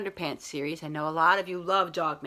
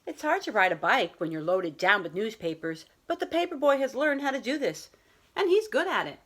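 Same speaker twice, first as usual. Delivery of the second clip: put-on children's picture book read aloud to a class; story time voice for young listeners; storybook reading. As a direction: read it like a teacher sharing a bedtime story. Teacher reads picture book aloud.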